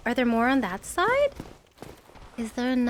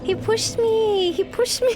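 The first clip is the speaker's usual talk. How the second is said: whiny complaint; 8 semitones higher, 4 dB louder, slower than usual. Real, not acted. Pushed off in game.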